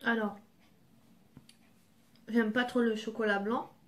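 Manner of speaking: talking while chewing; finishing a bite; mouth partly full. Real, not acted. Seen 4: Chews after biting bar, touches lips; talks freely from 4.5.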